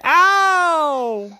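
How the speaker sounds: high-pitched drawn-out "ow"; cry of pain. A narrator's reading. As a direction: wail it out like a cartoon bump. Daddy hits his head.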